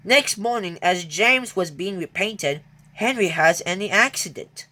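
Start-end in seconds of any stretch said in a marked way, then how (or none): none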